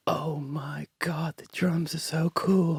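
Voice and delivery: put-on hushed childlike wonder whisper; awed whisper. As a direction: breathe it in quiet awe. Child's awe at drummer.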